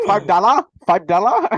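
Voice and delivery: put-on loud, high Chinese-accent caricature; mock Chinese accent. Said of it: "In their accent".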